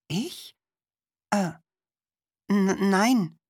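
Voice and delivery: put-on high, halting stammer of a shy boy; hesitant stammer, caught off guard. A narrator's reading. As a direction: stumble nervously over the words. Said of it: "stammelte Martin".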